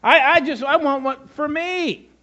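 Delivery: high-pitched selfish churchgoer whine; self-centred whining. Acted. Selfish churchgoer demanding what the church gives him, whining. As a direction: complain in a high, put-upon voice.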